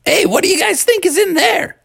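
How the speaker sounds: put-on high-pitched kid voice; Bubby. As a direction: pipe up high, asking the gang. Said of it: "And Bubby said".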